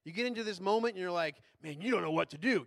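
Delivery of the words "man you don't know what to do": louder, scolding take; harsh self-talk. Shown as a demonstration. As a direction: say it like the critic beating you up. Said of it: "Change the tone of that voice".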